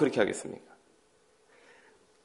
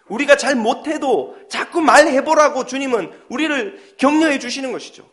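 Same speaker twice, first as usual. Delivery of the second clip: ordinary talk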